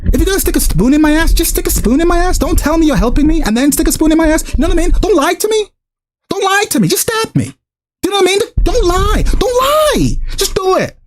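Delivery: high-pitched exasperated rant; so mad. Real, not acted. Streamer begging the developers not to lie to him, really mad. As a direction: plead angrily, pitch climbing to a peak. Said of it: "[name]'s so mad his accent turned Irish".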